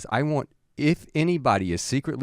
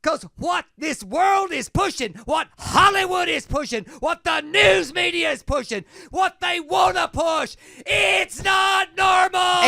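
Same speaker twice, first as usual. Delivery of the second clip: fiery preacher. Retold or imitated. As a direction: preach it at the top of your lungs. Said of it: "crazy sermon".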